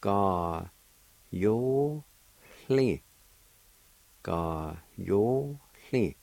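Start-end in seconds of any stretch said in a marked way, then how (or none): 0.0-3.0 Ga, yo, hli separated, rising on yo and hli; syllable by syllable. Shown as a demonstration. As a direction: lift the pitch on yo and hli. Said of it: "pronounced like this".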